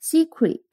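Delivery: secret with a long ee, said apart; sounding out secret. Shown as a demonstration. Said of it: "注意一下secretary的读音". (示范secret的读音)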